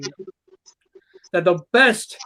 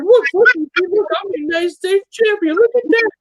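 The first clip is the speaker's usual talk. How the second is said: much higher, gloating show-off voice; mock bragging; 9 semitones higher than usual. Wrestler mocking a rival bragging about his belt, high and gloating. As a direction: squeal it like a kid showing off a toy. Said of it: "and you're like".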